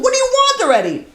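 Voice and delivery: very high-pitched exasperated squeal; frustrated outburst. Retold or imitated. Daughter worn down by endless knocking, high frustrated outburst. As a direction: act out being at wits' end, voice shooting up. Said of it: "She's so frustrated".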